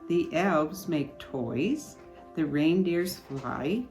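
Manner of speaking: usual speaking voice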